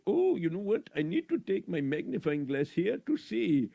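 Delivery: ordinary delivery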